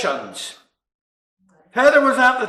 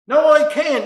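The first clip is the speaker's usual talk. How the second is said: loud, higher snapped no; irritated refusal; 6 semitones higher, 7 dB louder than usual. Acted. Homeowner woken at night refusing the drunk, loud and irritated. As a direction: bite the refusal off.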